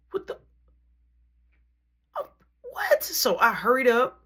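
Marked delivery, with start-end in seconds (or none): 0.1-3.0 shocked disbelief. Retold or imitated. Finds brother missing from class.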